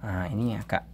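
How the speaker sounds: ordinary talk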